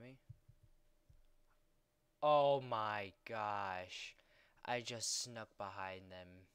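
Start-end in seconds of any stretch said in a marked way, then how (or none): 2.2-6.5 loud, slow, amazed oh my gosh; amazed shout. Real, not acted. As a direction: stretch it out in disbelief. Sneaking behind enemies.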